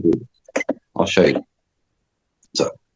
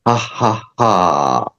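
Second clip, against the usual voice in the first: three unvoiced breathy ha exhales; voiceless ha; much slower than usual. Shown as a demonstration. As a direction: breathe ha out without voice. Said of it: "but without the vocal cords".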